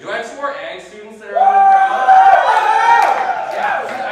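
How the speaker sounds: high, loud shout-out to the audience; rally the crowd with a rising yell; calling to crowd. Real, not acted. Host calls out to crowd.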